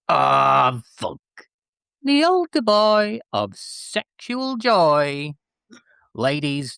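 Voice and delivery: slow, booming ring-announcer hype with big swoops up; wrestling entrance intro. Acted. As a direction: bring him out like a wrestler's entrance. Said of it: "that intro".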